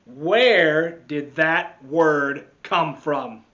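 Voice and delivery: loud, slow demanding question; stern teacher. Acted. Missus Dixon questions the class, slow and loud. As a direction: demand an answer. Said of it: "said Missus Dixon".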